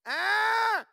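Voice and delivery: sharp high imitated baby shriek; baby squeal. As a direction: squeal like a toddler with messy hands. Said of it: "She just went".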